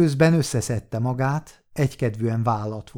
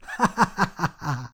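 acted burst of laughter; laughing out loud; 4 semitones higher, 3 dB quieter than usual. A narrator's reading. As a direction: laugh out, mocking the speaker. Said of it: "s hangosan fölnevetett". (hangos, színpadias nevetés)

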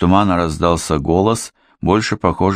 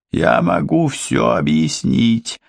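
moaning; 7 semitones higher, slower than usual. A narrator's reading. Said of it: "простонал Иосия".

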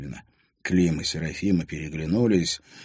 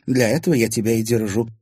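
smirking retort; 5 semitones higher, 5 dB louder than usual. A narrator's reading. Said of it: "хмыкнула".

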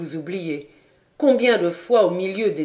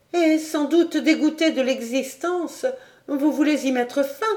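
put-on higher young woman's voice, urgent and pleading; Clara pleading; 9 semitones higher than usual. Clara begging du Granec not to leave, higher pleading voice. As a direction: beg him to stay, voice lifted.

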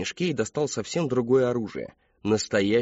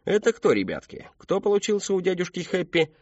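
lilting question climbing high; clown entertainer; 8 semitones higher than usual. A narrator's reading. Clown quizzes children on his balloon animal, playful showman. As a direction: play up to small children.

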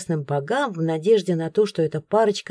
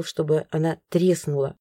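ordinary delivery

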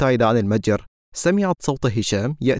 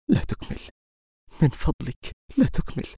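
hushed, nearly whispered plea; barely voiced, begging him to stop; 5 semitones higher, 4 dB quieter than usual. A narrator's reading. She stops his confession mid-sentence, hushed and pleading.

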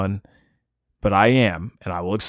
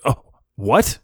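imitating a startled receptionist, rising sharply on "what"; sudden mood change; 3 semitones higher than usual. Receptionist hearing where the dog bit him, taken aback. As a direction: take it in, then a startled rising question. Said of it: "all of a sudden her mood changes".

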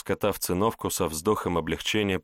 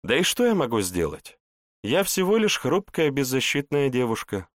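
mock helpless; 7 semitones higher than usual. Sorceress feigns helplessness.

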